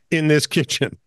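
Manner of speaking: normal talking voice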